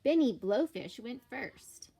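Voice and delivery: usual speaking voice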